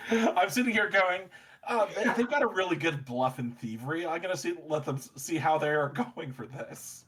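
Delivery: talking through laughter, high and loud; real laughter running through the words; laughing. Real, not acted. GM cracking up at the plan.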